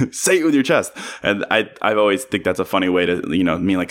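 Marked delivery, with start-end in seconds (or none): 0.1-0.9 high, playful mock yell; yelling uncle impression. Host quoting comedy bit about pushy uncle, mock yell. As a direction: repeat the uncle's catchphrase in a higher voice. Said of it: "he keeps yelling at Kevin".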